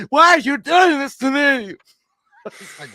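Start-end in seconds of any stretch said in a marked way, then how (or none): 0.1-1.8 very high, loud, slow wail; mock wailing wife. Mocking the wife's hysterical plea, high wail. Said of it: "she's like".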